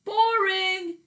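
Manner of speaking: high, loud echoing call. Acted. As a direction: bounce it back as the echo. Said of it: "the echo called back".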